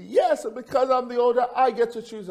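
put-on high, loud child's protest; mock kid's yell, pitched right up; screaming young son. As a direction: imitate an indignant little boy insisting. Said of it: "he's screaming because it's his turn".

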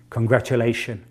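ordinary talk, nothing marked

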